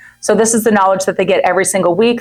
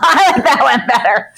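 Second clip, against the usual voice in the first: laughing pick; 6 semitones higher than usual. Retold or imitated. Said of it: "And I'm all".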